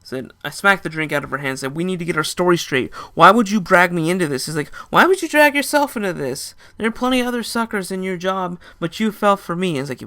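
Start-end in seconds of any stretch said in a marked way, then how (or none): 1.8-4.5 loud and angry, pitched up; angry confrontation. Retold or imitated. Narrator confronting the woman, loud and angry. 4.9-9.7 high, loud mock female voice; teasing woman's voice. Woman mocking the narrator, in a high teasing voice.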